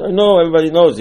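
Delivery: loud, high acted protest; impatient objection. Listener objects to retelling Exodus, impatient protest. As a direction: protest as if it is all old news.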